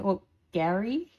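normal talking voice

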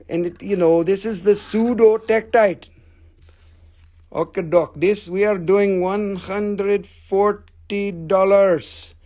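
thick put-on foreign accent, every word separately pronounced; broken-English foreign accent for a sales pitch; put-on foreign seller accent. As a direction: play the haggling vendor with a foreign accent.

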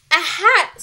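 high, loud urgent shout. A narrator's reading. Girl in the mouse hunt, shouting. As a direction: shout out a sudden idea. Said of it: "someone shouted".

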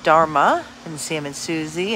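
ordinary talk

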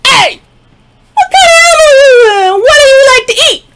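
high, loud froggy shout up at the cow. Acted. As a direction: shout way up like a tiny brash frog. Frog shouts up at big cow.

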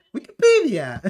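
high mock British surprise; startled British pronunciation, imitated; Henry Cavill. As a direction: exclaim it in an exaggerated British accent. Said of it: "se sorprende y le sale lo británico".

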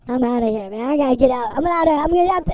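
ordinary talk

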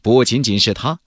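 usual speaking voice

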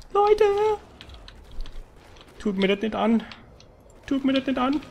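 high, pleading cry; desperate plea. Real, not acted. Streamer begging his viewers while dying, high pleading. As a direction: beg them not to do it.

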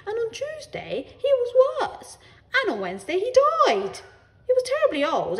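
relate the pet's death with great drama; storytelling neighbour. A narrator's reading.

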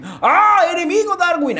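imitando un grito de alerta, mucho más agudo y fuerte; grito de aviso. Retold or imitated. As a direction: grita el aviso como si viera al enemigo. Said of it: "me gritan, mano". (imitando al chat que le grita que hay un enemigo)

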